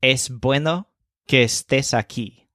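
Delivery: Es bueno que estés aquí in clear Spanish, falling on que; Spanish model sentence; Spanish pronunciation. Shown as a demonstration.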